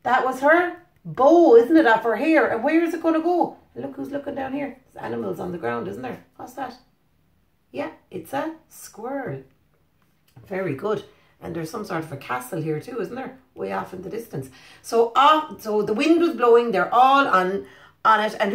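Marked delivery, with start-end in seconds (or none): 3.7-14.5 hushed. Real, not acted. Quiet aside to child over picture.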